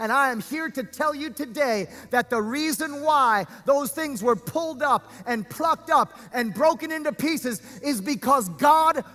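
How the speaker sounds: impassioned, projected sermon voice; preaching. Real, not acted. Preacher tells congregation why things were taken, preaching. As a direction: drive the point home to the congregation.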